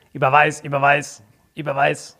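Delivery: talking dog voice; pet voice. Retold or imitated. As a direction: bark the order like a dog. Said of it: "Was sagt der Hund?".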